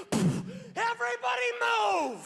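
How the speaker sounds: very high, drawn-out yell; hammed-up emergency holler; urgent mock shout. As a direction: clear the room with a hollered warning. Acted warning shout in restroom.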